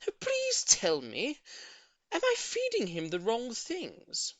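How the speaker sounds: slow, high pleading; eager begging. A narrator's reading. Old lady begging neighbour for the secret, slow plea. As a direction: beg sweetly. Mrs Silver begs.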